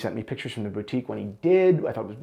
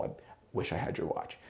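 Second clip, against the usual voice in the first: whispered, envious; wistful whisper; 6 semitones lower, 11 dB quieter than usual. Real, not acted. Reviewer to his friend Shane, an envious whisper. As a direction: breathe it as a private confession.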